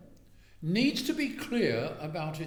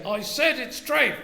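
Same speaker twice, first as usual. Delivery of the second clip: loud, slow, higher bark of a bully; boorish shout; 9 semitones higher, 8 dB louder than usual. Retold or imitated. Boorish husband declaring the chicken treif, shouting slowly. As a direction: shout it down like a brute.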